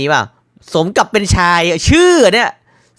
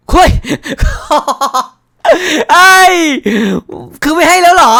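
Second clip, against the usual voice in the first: excited outcry; 10 semitones higher, 4 dB louder than usual. Real, not acted. Game NPC refuses him.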